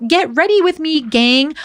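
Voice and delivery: high-pitched imitation of a chirpy vlogger; mock social media influencer. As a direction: greet followers like an online influencer. Mimicking today's screen-raised young talkers.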